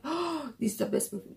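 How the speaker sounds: gasp, then a hushed mock amazement; mock wowed. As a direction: act out being wowed, with an audible intake of breath. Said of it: "not something that I'm wowed".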